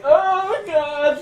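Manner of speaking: very high, loud wail; shrieked mock horror; Aelin. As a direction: cry out in hammed-up dismay. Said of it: "Aelin is like".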